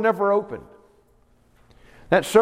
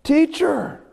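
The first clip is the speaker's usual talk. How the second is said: amazed recognition; 12 semitones higher than usual. Acted. Mary answers Jesus on hearing her name, high exclamation. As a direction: call out high in sudden recognition. Mary's cry of recognition, translated.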